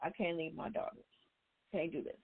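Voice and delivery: a quiet, teary echo of a mother refusing to leave; tearful, quiet. Retold or imitated. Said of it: "tears are coming down her face".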